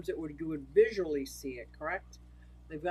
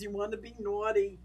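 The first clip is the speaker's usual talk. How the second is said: ordinary delivery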